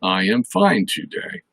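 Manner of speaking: "I am fine today" kept level to the end; plain declarative tune, no lift on "today"; level statement tune. Shown as a demonstration. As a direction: say "today" flat or falling. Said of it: "you might want to go down a little bit".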